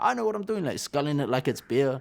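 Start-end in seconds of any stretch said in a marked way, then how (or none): 0.0-0.6 higher-pitched mock brush-off; imitated overconfident comeback; retold cocky retort. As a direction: act out waving away a warning. His retort to the warning.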